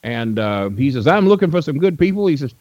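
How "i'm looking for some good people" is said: much higher put-on old promoter voice; imitating Nick Gulas. Old promoter greeting a young fan, high imitated voice. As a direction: play the old wrestling boss greeting a stranger, pitched way up. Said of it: "That, that's kinda how he talked".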